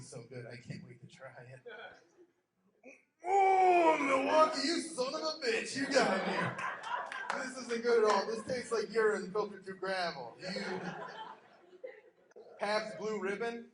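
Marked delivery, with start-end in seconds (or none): 3.3-13.7 hammed-up loud, high wail of betrayal; loud mock sobbing outrage; mock anguished cry. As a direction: cry out like the beer betrayed you. Acted beer drinker's disgust.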